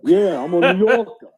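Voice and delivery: laughing, hammed-up New York accent; tossing off a joking New York accent between laughs; mock New York accent. Host mocking New Yorkers, laughing through a New York accent. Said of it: "I mean, I make fun of New Yorker".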